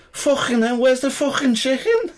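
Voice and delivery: high-pitched hammed-up Scouse; Scouse accent. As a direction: burst in as a squawking Liverpudlian. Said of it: "comes this Scouse guy going".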